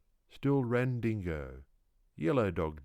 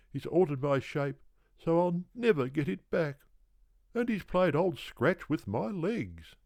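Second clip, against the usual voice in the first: put-on tired old kangaroo, complaining; 9 semitones higher than usual. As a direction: whine out your wrongs like a worn-out old kangaroo.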